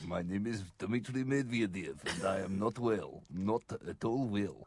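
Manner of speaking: put-on low, soft Russian accent of a sick man; ailing Russian accent. Russian leader saying he is ill, weak deep Russian accent. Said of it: "a voice you can do while you're ill as well".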